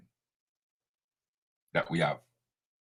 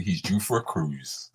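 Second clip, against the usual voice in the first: softer and lower, a bit slower, as a stock phrase; quoting a saying; 6 semitones lower, 8 dB quieter, slower than usual. Shown as a demonstration. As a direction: tuck it away like a well-known saying. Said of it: "as they say".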